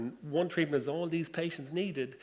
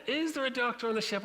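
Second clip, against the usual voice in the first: higher, announcer calling out; ship tannoy call; 8 semitones higher than usual. Retold or imitated. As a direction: crew calls over the speakers for a doctor, announcer voice.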